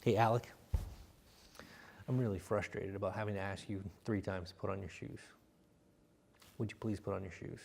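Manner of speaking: low, very soft and level; quietly firm, close up; calm serious. Shown as a demonstration. Coach showing calm seriousness to a son, low and very soft. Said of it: "coming over and be like".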